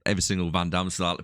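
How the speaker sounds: ordinary talk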